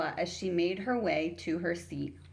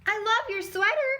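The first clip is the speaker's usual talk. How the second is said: put-on much higher, louder gushing girl; excited gasp; 6 dB louder than usual. New girl gasping with delight at the bully's sweater. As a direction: gush over the sweater breathlessly. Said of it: "Carla gasped".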